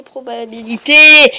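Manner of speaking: playful rising shout on probabilités; mock-emphatic outburst. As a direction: build to a loud, high last word.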